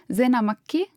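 ordinary delivery

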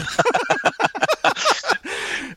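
high breathy laugh; laughing hard. Real, not acted. After quoting the hippies line, real laugh. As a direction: dissolve into giggles.

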